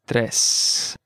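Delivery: "Tres" drawn out slowly; slow model. Shown as a demonstration. Teacher modelling vocabulary for learners, slow "Tres". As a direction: stretch out each sound of "Tres".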